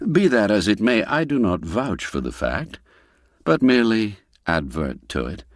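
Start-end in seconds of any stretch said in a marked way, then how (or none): none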